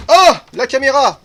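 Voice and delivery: loud, high alarmed cry; sudden alarm at a falling camera; startled shout. Real, not acted.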